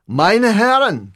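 loud, high, drawn-out address; commanding call. A narrator's reading. Hitler figure addressing the hospital ward, commanding call. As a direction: call the room to attention.

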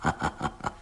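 low, breathy snicker; soft scheming chuckle. Acted. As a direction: chuckle quietly to yourself over the revenge. Vengeful constable ends the story.

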